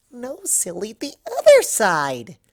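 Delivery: high, fast, bubbly pony chatter; Pinkie Pie voice. Acted. Pinkie invites Rarity to a party, high and breathless. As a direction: gush it out like an excited party pony.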